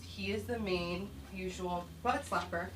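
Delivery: ordinary delivery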